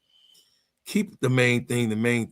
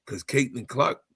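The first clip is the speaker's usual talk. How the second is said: normal talking voice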